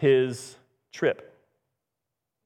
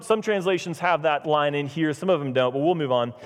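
normal talking voice

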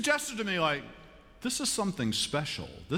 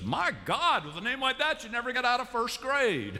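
put-on higher, cheeky kid voice; a brassy child blurting; a touch of brash kid; brash kid Imogene; 11 semitones higher than usual. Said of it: "And Imogene says".

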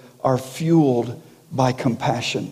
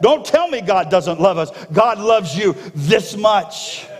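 higher, ringing preacher's cry; full-force preaching; 4 semitones higher than usual. Acted. Pastor voicing God's mercy to the church, preaching hard. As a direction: lift it up and drive it home.

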